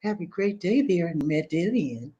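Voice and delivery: a light, sweet-voiced reading. Host reading a viewer's message, sweet and slow. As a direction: read it sweetly and slowly. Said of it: "She talks sweet".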